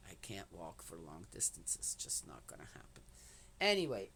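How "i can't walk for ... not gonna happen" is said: soft breathy whisper to herself; whispered aside. Real, not acted. Admitting she can't walk far, whispered to herself. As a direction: let it drop to a whisper. Aside about walking limits.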